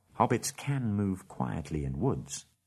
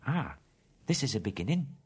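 higher, pleased little voice; pleased hobbit; 6 semitones higher than usual. Acted. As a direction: Bilbo finding the purse, pleased. Bilbo pleased at his theft.

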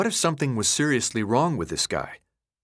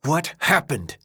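upset; 5 semitones higher, slower than usual. A narrator's reading. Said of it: "clearly upset".

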